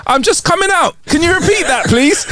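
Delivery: high muffled voice from under a sheet; squeaky voice muffled from below. Acted. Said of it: "a muffled voice came out from under the sheet".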